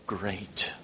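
dropped to a near whisper; low, whispered close to a reading; hushed solemnity. Real, not acted. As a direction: let the final word fall away, hushed and grave. Solemn last word of reading.

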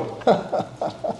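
high, soft breathy giggle mimicking someone; a thin airy fake giggle; mock laugh. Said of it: "you know, it's always".

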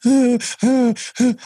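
high, hammed-up boyish sobs; imitating a little boy bawling, then laughing it off; mock little boy sobbing. Telling of breath work tears, mocks a little boy's high sobs. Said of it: "crying like a little boy".

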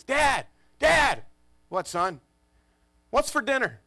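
put-on high, offhand kid voice; casual kid voice. Oldest son asking dad about dinner, high and offhand. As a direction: sound totally unbothered.

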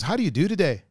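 lilting Irish question that goes back up; light Irish lilt, up at the end; Irish accent. Shown as a demonstration. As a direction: show Irish with the tune rising. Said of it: "So it goes back up".

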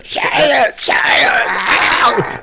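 high, loud screaming impression; mock screamed demand; Sam Kinison. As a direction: scream it like a raging comic. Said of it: "like, screaming guy".

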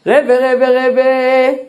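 high, loud, pleading calls of rebbe; pleading to the rabbi. Retold or imitated. אבא מתחנן לפני הרב שך. (תחינה נרגשת, גבוהה ורמה)

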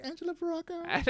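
very high, squeaky mock-female voice; put-on high, coy name drop; girlish introduction. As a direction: imitate a giggly woman in falsetto. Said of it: "She giggles".

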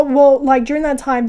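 ordinary delivery

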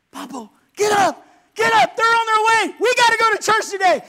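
high, loud urgent shouting; excited yelling. Retold or imitated. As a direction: yell to wake him, all in a hurry. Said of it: "runs in their other room and says".

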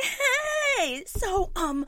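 high, strained fake-casual voice. Mother faking calm to the office staff, high and strained. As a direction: sound breezy while panicking. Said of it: "So I go to the office".

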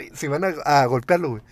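ordinary talk, nothing marked

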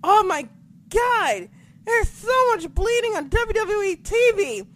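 high loud astonished cry; shocked excitement. Retold or imitated. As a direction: squeal it over the blood. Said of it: "And I was like".

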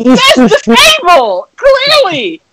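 high-pitched, drawn-out outburst; exasperated yelling. Retold or imitated. Yelling at the film character who missed the button, exasperated.

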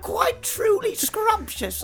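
very high, slow mimicry; exaggerated falsetto imitation; quoted catchphrase. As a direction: draw it out in a silly high voice. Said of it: "or whatever she said".

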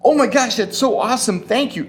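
hammed-up thrilled thank-you; mock gushing delight. Preacher pretending Zechariah's grateful reply, mock gushing. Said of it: "this is how Zechariah responded".

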